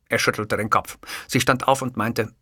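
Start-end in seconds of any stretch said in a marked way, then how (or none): none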